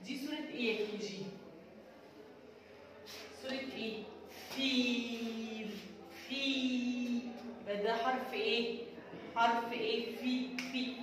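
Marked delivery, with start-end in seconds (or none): 4.5-7.3 drawn-out, very slow phonics word; slow teacher sounding-out; stretched out slowly. Shown as a demonstration. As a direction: stretch فيل out long so children hear each sound. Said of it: "teacher sounds out the picture name".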